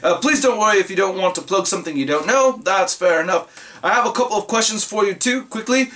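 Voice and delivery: put-on amateur British accent, reading aloud; imitation British letter-reader. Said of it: "do this in the British accent".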